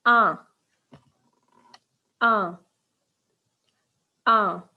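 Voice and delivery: Un said loud and clear as a nasal vowel; loud teacher model of Un; model pronunciation. Shown as a demonstration. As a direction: say Un slowly and clearly for the class. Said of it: "Comment on dit one".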